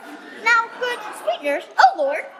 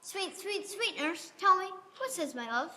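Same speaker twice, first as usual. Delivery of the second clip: much softer, coaxing and tender; a girl softly sweet-talking her nurse; 8 dB quieter than usual. Acted. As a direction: wheedle the nurse gently. Juliet coaxes the nurse sweetly.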